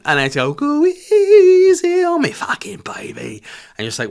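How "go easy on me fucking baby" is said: high, slow whispered crooning of the title. Retold or imitated. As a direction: breathe the song title out like a crude tease. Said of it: "And her talking voice, horrendous".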